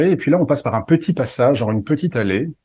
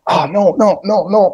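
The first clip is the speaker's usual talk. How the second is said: much higher and louder, rising panic; mock panicked protest; 8 semitones higher, 6 dB louder, slower than usual. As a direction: squeal a hammed-up no, no, no. Attacked by flying chips bag.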